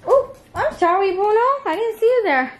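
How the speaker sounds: high-pitched pet-talk apology; apologising to a pet. Real, not acted. Owner who didn't see Bruno there, high cooing apology. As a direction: pitch it right up, as if cooing to a dog.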